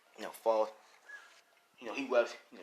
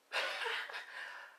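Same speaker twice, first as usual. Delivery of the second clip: low-volume villain cackle; creepy Joker-like chuckle; creepy Goblin laugh; 12 dB quieter than usual. Acted. Gremlin greeting Spider-Man, creepy cackle. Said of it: "Green Goblin laugh is creepy".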